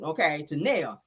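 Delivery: normal talking voice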